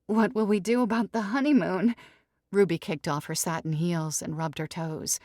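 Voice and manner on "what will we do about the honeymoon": high, loud pleading; cling to the promise; jilted bride insisting; wounded bride. Acted. Ruby asks.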